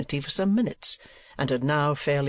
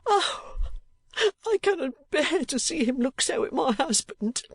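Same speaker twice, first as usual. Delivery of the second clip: much higher wailing wife's voice; tearful cry; 13 semitones higher than usual. A narrator's reading. Wife cries out to defend her husband from the uncle. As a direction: wail it in distress. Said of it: "cried Missus Kenwigs".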